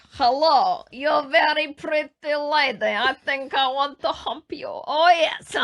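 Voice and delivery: loud, slow mock-Romanian dog voice; Gru-like Romanian accent. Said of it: "say I sound like Gru from".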